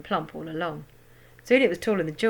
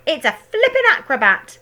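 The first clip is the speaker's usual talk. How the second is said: high loud crone voice; shouting grandma; 8 semitones higher, 8 dB louder than usual. A narrator's reading. Grandma shouting from the rooftop about the hen. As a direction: crow it out in delight. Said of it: "Grandma shouted from the rooftop".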